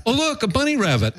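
high, sudden wonder; mock distracted delight. Client spots bunnies mid-argument, mock distracted delight. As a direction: break off into high-pitched childlike discovery.

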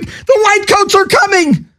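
high, hammed-up panicked warning; mock panic. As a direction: squeal it like a lunatic who hears the orderlies coming.

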